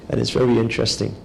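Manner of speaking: normal talking voice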